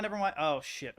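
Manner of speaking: ordinary delivery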